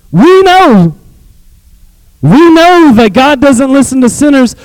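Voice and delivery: fiery preaching; preaching at full force. Acted. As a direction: drive the point home. Preaching peak.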